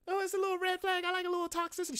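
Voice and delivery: mocking falsetto parody. Mocking someone's excuse for toxicity.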